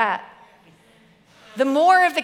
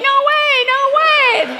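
put-on much higher and louder, a child's wail; screaming toddler; 13 semitones higher, 7 dB louder, slower than usual. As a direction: wail it like a struggling three-year-old. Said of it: "He's still going".

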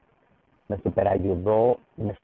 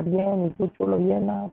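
camp friend greeting; 10 semitones higher than usual. Retold or imitated. Friend's flirty greeting retold.

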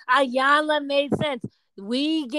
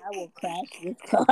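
low, soft imitation of a threatening husband; understated mock menace, pitched down; mimicked husband threat; 8 semitones lower, 7 dB quieter than usual. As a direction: drop the voice for his quiet threat. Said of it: "over you and said".

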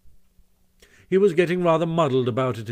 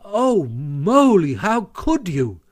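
slow, higher-pitched reproach; dismayed; 6 semitones higher, 5 dB louder, much slower than usual. A narrator's reading. Rat reproaches Mole for his reckless prank, dismayed. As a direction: draw it out in dismay. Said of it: "said the Rat, dismayed".

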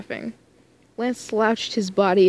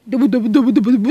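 loud, fast gibberish babble; rapid-fire dubba dubba mockery; 3 semitones higher, 9 dB louder, much faster than usual. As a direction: imitate rambling nonsense. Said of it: "how can Lance come in here like".

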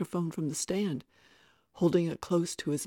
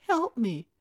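put-on high, girlish cry for help; begging; 6 semitones higher, 4 dB louder than usual. A narrator's reading. Tara to Mike as she is held, begging. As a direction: plead to be rescued. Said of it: "Tara begged".

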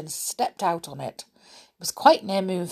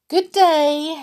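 high, loud farewell; parting call; 10 semitones higher, 7 dB louder than usual. A narrator's reading. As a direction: call a goodbye, pitched up. Mother bids annoying visitor goodbye.

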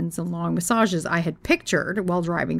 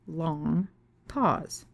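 ordinary talk

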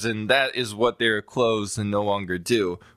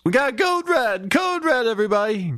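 alarm shout; 12 semitones higher than usual. Acted. Alarm call repeated.

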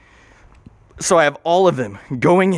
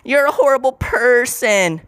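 high, slow mocking voice; imitating a preachy critic who calls him horrible; mocking accuser; 9 semitones higher than usual. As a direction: parody a scolding critic, much higher. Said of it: "don't come at me with this".

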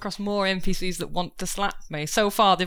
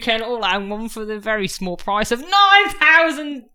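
put-on Del Boy; 2 semitones higher, 4 dB louder than usual. Trader pitching stolen watches to crowd, cockney patter. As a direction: sell dodgy watches like a market trader. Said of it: "my name's Del Boy".

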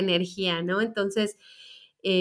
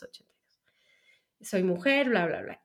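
whispered; 2 semitones lower, 4 dB quieter than usual. Retold or imitated. Reciting her own name and birth date.

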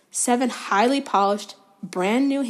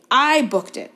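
spitting with rage; 4 semitones higher, 5 dB louder, slower than usual. A narrator's reading. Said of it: "positively spitting with rage".

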